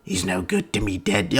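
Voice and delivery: lightly voiced Boba Fett, quiet and smug; a hint of a cocky bounty hunter; uppity Boba Fett. Mock Boba Fett quip to Vader, lightly voiced. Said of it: "he was really uppity with Vader".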